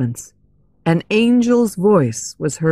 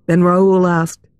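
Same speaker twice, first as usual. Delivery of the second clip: ordinary delivery, nothing marked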